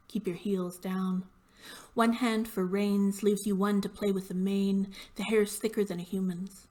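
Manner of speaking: firm, measured poetry recital; poem reading. Acted. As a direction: read the poem in deliberate, emphatic phrases. Reads own poem aloud.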